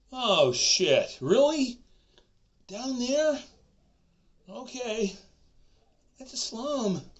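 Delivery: high, loud mock shock. A man hearing she lives in the slum, mock shock. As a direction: react with mock horror.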